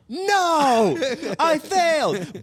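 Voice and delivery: high, anguished wail of defeat; mock-tragic high wail; anguished cry. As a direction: cry out that you failed, high and drawn out. Panicked over missing sign-off.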